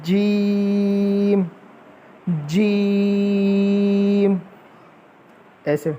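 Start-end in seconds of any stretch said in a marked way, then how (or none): 0.0-4.4 जीम very slow, with a held echo; stretched jeem. Shown as a demonstration. Teacher modeling a long, echoing जीम for the class. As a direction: draw out जीम with a double sound. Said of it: "double आवाज़ करके".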